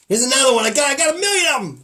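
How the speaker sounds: put-on loud, high, fast old-school comic patter; hammy comedian. Comic riffing loudly to his co-host. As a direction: ham it up like a stand-up.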